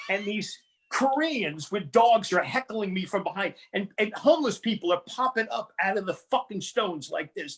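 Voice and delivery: ordinary delivery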